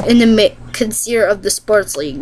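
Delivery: usual speaking voice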